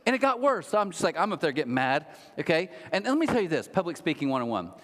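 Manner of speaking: normal talking voice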